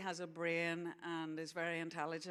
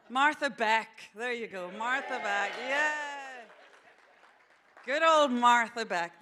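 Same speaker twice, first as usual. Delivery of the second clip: excited; 6 semitones higher, 14 dB louder than usual. Real, not acted. Loud cheering for author.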